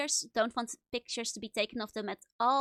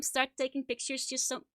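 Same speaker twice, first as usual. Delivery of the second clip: ordinary talk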